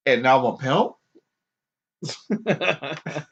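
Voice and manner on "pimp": ordinary talk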